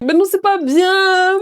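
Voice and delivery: put-on high, drawn-out protest in Mahoran accent. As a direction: imitate a child objecting in Mahoran accent. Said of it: "Avec l'accent". (protestation aiguë, accent mahorais)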